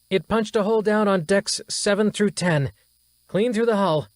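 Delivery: high, urgent, strained officer voice; alarmed officer. Acted. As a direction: report the damage in a rising panic. Officer reporting hull breach.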